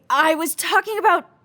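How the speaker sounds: normal talking voice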